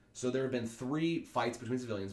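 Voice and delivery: ordinary talk, nothing marked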